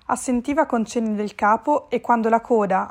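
usual speaking voice

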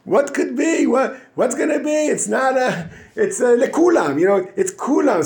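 hammed-up complacent voice, laughing; smug reassurance. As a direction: play the sinner sure the crowd protects him. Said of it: "He'll say".

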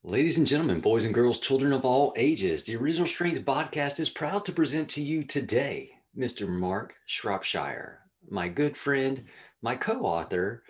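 big ringmaster introduction, building up; announcer voice. Acted. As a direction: announce him like a circus ringmaster. Host announces the guest, showman style.

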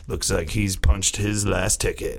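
low, lightly gravelly detective quip; growly cop voice. Pretend. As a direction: growl the one-liner like a TV cop. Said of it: "real growly, grumble voice".

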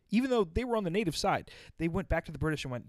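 ordinary delivery

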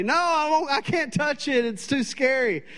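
very high, frightened refusal; mock hysterical wife. Wife refusing her husband over the phone, very high mock panic. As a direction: whine it like you're terrified of a toad. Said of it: "crying hysterically".

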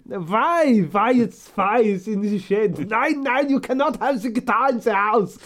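high, loud mock outrage; mock indignant shriek. To his co-host about the shed guitar, high mock outrage. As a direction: squeal it like an offended mum.

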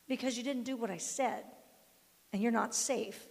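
normal talking voice

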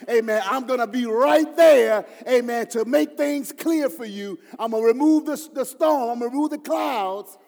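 building preaching. Acted. Preaching at full force.

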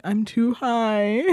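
slow, dragged-out stoned mumble; drawn out like barely holding on; dazed slow. Retold or imitated. Telling best friend on the phone she is too high, slow and dazed.

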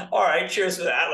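dry ironic gratitude after a shove; sarcastic thanks. Retold or imitated. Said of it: "And you're like".

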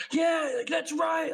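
high-pitched mock victory yell; imitated triumphant scream. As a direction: yell it high, taunting the other team. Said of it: "scream at the top of your lungs".

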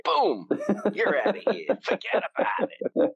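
brash New York tough-guy voice, laughing; mock New York accent. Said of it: "I fucking love that New York accent".

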